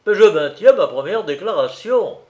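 firm and energetic conclusion; energetic insistence. A narrator's reading. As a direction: restate it forcefully, standing firm. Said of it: "toujours avec énergie, concluait".

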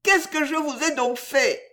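high plaintive protest; high-pitched, desperate lament; despairing tearful exclamation. A narrator's reading. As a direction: wail it as an injured victim. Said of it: "pleurant avec des exclamations désespérées".

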